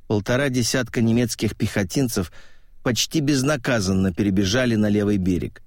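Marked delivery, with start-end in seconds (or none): none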